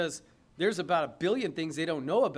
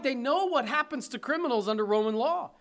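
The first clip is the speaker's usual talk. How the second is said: normal talking voice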